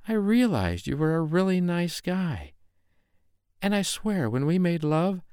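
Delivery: high, tearful confession; a scared girl begging to be believed; frightened young woman pleading. Acted. Mariah confesses her fear to angry KC, high and pleading.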